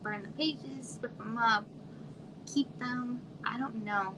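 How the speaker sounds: ordinary delivery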